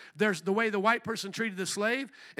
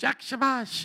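a quick Polish greeting, a touch higher; preacher joking about his Polish accent; 4 semitones higher, slower than usual. Retold or imitated. Light Polish-accented greeting. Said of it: "my Polish".

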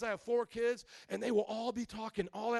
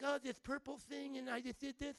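put-on higher, softer lisping kid voice; young child's chatter; 6 semitones higher, 6 dB quieter than usual. Father imitates his kids all talking, small child voice. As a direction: babble it like an excited little kid. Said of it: "sometimes they'll just be like".

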